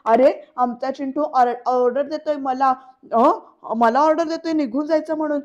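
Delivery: loud taunting ridicule; mock him like a child giving orders; deliberate mockery. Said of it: "मुद्दामून अशा टाळ्या वाजवतो". (मुद्दाम चिडवत मोठ्याने टोमणा)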